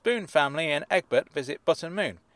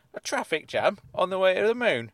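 incredulous; 7 semitones higher, 3 dB louder than usual. Real, not acted. Incredulous at synopsis.

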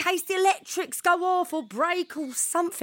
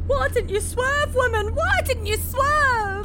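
upset shouting; 8 semitones higher than usual. Acted. After running over pigeon.

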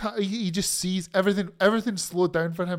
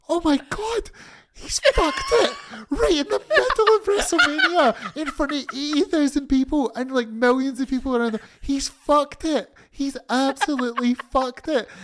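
much higher, half-laughing gasp of disbelief; stunned disbelief; 5 semitones higher than usual. Retold or imitated. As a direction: replay the shock of the whole room. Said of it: "We're all looking going".

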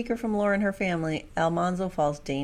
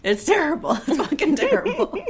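laughing through the words, higher; 11 semitones higher, 4 dB louder than usual. Real, not acted. Sisters laughing about a terrible old game, laughing. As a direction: giggle while complaining. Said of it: "laughing about a bad game".

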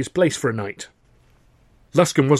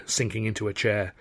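ordinary delivery

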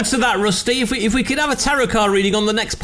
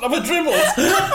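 laughing; 6 semitones higher than usual. Real, not acted. Laughing at dribbling chocolate.

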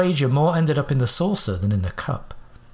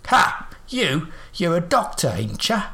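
higher old man voice, mocking; scoffing old man; 5 semitones higher, slower than usual. Old man sneering at Watson being a doctor, scoffing. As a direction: scoff at the doctor like a cheeky codger. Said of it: "his impertinent tone".

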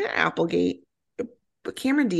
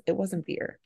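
muttered aside; quiet grumbling correction; under her breath; 3 semitones higher, 6 dB quieter than usual. Retold or imitated. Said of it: "under her breath goes".

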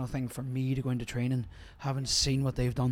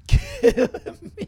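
laughing through the words; 10 dB louder, much slower than usual. Real, not acted. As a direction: laugh it out, pitched way up. Laughing about hard training.

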